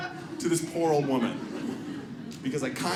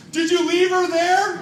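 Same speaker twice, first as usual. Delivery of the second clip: heckler's question; 10 semitones higher, 4 dB louder, slower than usual. Retold or imitated. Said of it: "some guy just goes".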